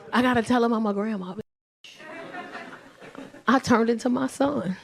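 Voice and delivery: ordinary talk, nothing marked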